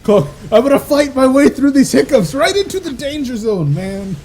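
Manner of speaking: high, loud hyped-up yell; excited shout. Real, not acted. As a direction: belt it out like a battle cry. Fights through hiccups, hyped.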